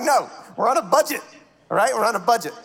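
loud, high protest; mock defensive. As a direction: insist on it, a little indignant. Retold indignant reply to stranger.

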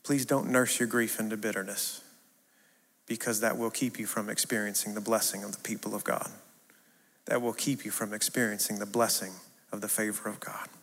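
soft, low, gentle plea; tender. Real, not acted. Preacher pleading tenderly with the grieving in his church, soft and low.

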